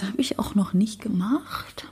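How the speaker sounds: sheepish whispered confession. Real, not acted. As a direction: whisper it like a guilty secret. Embarrassed admission, whispered.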